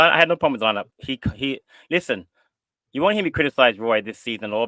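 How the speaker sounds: ordinary talk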